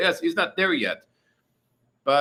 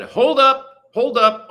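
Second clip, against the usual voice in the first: higher and louder, excited call; 9 semitones higher, 7 dB louder, slower than usual. Real, not acted. Streamer halts chat for a Super Chat, excited. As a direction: stop everything, a donation just came in. Stopping to read a donation.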